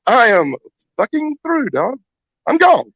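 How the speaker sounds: wailing that it's all over; mock panic. Busted in front of Dusty, high mock wail. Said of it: "I'm like".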